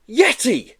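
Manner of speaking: high, loud excited yell. Acted. Joe recognizing the creature, loud high yell. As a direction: shout the discovery. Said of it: "he yelled".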